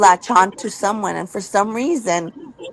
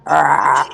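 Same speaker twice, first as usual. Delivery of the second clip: low, drawn-out ghostly groan; eerie growl; 7 semitones lower than usual. Retold or imitated. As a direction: imitate the strange growl heard on the phone. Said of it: "I don't know if I can make the exact sound".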